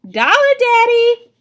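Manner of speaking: put-on high, loud, gleeful child; cheeky kid. A narrator's reading. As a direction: shout it like a grinning kid.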